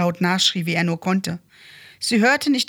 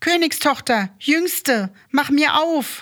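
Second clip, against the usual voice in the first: high-pitched storybook call; 7 semitones higher than usual. A narrator's reading. Frog at the door calls the youngest princess, calling out. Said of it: "klopfte es an die Tür und rief".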